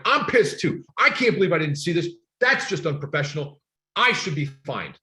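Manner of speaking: match the caller's anger, half in jest; mock anger. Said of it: "I got pissed".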